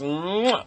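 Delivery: drawn-out kiss noise; kiss sound. Shown as a demonstration. Cousin showing how the childhood kiss went, smacking kiss sound. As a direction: make a big smacking mwah. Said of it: "like that".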